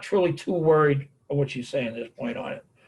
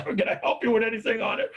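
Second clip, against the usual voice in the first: higher-pitched, mock-helpful offer; eager shop helper; 8 semitones higher than usual. Storekeeper trailing a customer round the store, mock-eager.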